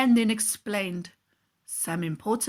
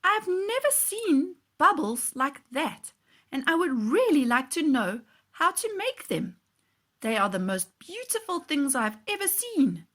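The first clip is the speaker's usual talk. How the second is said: put-on a boy marvelling at bubbles; eager boy; 6 semitones higher than usual. Tristan tells the man he admires his bubbles, lightly voiced child. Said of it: "Tristan said".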